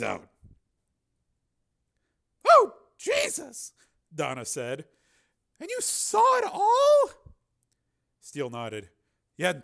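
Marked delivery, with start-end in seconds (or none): 2.5-3.7 Donna shocked. Acted. Donna reacts to the killings. 5.6-7.1 Donna asking. Acted. Donna asks Steele in disbelief.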